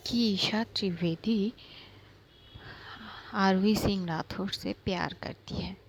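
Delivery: parade her confession as a joke; mocking announcement. Aaru mocking Isha before class.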